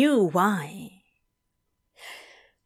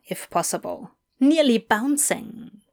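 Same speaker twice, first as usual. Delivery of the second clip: ordinary delivery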